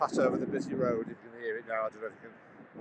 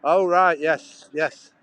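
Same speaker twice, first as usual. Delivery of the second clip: surprised; 5 semitones higher, 13 dB louder, much slower than usual. Real, not acted. Suddenly reads a viewer comment.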